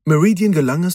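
ordinary delivery

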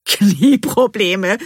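loud, high, drawn-out splutter. A narrator's reading. Bean to her friend once the workman leaves, spluttering. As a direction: burst out with the word, stretching it slowly. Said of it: "pustet Bean heraus".

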